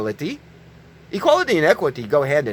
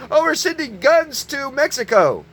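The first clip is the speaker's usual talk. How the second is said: mock Biden quote; 8 semitones higher than usual. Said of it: "leaders like, Biden recently".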